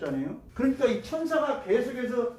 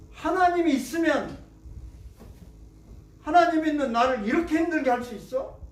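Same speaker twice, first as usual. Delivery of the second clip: raised, louder protest at God; indignant complaint; 7 semitones higher, 4 dB louder than usual. Retold or imitated. Complaining voice of the troubled. (하나님께 항의하듯 높고 크게)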